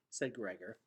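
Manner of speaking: plain reading voice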